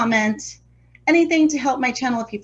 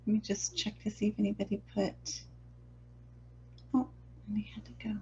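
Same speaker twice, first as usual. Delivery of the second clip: hushed, half-whispered aside; whispering; 16 dB quieter, faster than usual. Real, not acted. Painter checking the chat for anyone's posts, whispering. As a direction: murmur it very softly under your breath. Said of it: "you like the whisper?".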